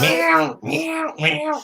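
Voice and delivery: high, whiny cat meows; cat meow imitation. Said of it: "the most loudest and annoyingest meow".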